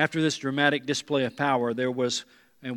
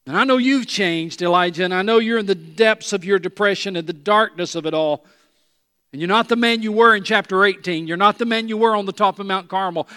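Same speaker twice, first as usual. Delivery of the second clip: loud, raised preacher's voice; impassioned preaching; 6 semitones higher, 10 dB louder than usual. Acted. Preacher voices God reassuring Elijah, impassioned preaching. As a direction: preach it out forcefully, high and loud.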